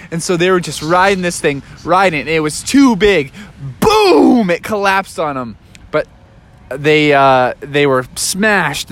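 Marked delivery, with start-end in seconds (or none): none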